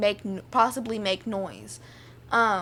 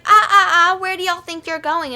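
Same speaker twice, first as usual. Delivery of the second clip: loud, high mock-teacher reprimand; scolding teacher; 7 semitones higher, 9 dB louder than usual. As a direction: stop the kids sharply, imitating a bossy teacher. Retold teacher stopping kids at door.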